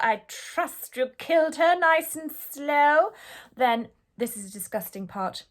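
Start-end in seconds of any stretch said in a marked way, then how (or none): 0.0-3.1 gleeful queen. A narrator's reading. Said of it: "The Queen cried out".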